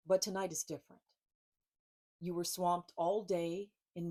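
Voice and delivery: lightly voiced book reading, measured pauses; gentle storyteller reading with long pauses; story narration. A narrator's reading. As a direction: read the tarot story aloud to listeners. Reads her tarot book aloud.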